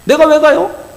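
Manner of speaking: high, loud incredulous why me; reluctant protest. Acted. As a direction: push back at God, indignant. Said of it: "뭐라고 그래요, 모세가?".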